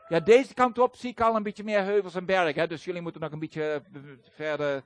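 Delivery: normal talking voice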